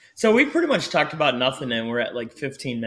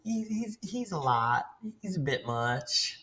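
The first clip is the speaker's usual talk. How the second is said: Texan saying; 5 semitones higher, 9 dB quieter, slower than usual. Retold or imitated. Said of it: "say like the Texans say".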